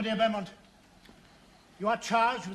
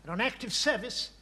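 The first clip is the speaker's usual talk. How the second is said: ordinary talk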